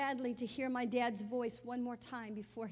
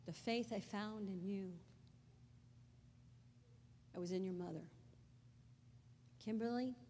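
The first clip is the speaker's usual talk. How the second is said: quiet retelling; 3 semitones lower, 7 dB quieter than usual. Retold or imitated. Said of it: "this is what she said to me".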